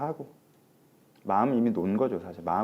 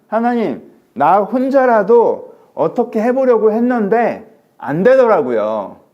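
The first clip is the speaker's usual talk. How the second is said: high, loud, very slow wail; anguished lament; 11 semitones higher, 11 dB louder, much slower than usual. Acted. Elijah cries to God he failed alone, drawn out. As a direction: stretch out 해보려고 in anguish.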